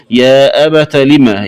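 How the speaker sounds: أبت said "abata"; open ta. Shown as a demonstration. Teacher showing the linked reading, أبت with final a. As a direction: end أبت on short a before لما. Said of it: "بفتح التاء".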